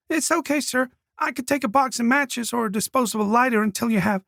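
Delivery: put-on high, polite young girl; timid girl, eager to please; shy girl voice. A narrator's reading. Colette voiced by narrator.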